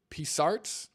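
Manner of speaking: P said as a separate letter in "P Sarts?"; unsure name pronunciation. Real, not acted. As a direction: try "P Sarts?" as "pee sarts", questioning. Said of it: "I don't know how to pronounce your name".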